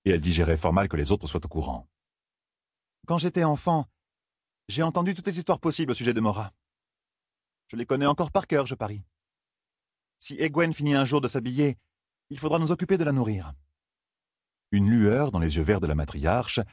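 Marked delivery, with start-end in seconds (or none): 3.0-13.5 higher, light elder woman; fond elder recalling childhood. Acted. Amis recalls stories.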